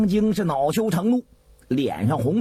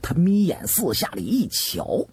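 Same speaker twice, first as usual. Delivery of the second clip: plain reading voice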